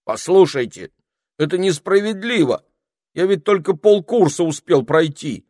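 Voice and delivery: high, loud, aggrieved excuse; wounded, high-pitched protest; hurt self-justifying. A narrator's reading. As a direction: plead offendedly that it's unfair. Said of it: "обиженно оправдывался Этельред".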